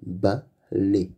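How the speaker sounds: balai split into ba, pause, lai; syllable by syllable. Shown as a demonstration. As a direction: sound out "Balai" in two separate syllables. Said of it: "on n'entend pas le son ble".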